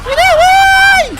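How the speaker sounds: very high, very slow loud wail; stretched horrified yell; long loud high shriek; drawn-out shriek. Real, not acted. Boss becomes a dragon.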